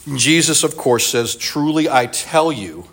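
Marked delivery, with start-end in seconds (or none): 1.4-2.9 "Truly I tell you" slowed, each word weighted; slow emphasis. Shown as a demonstration. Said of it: "he's kind of focusing in".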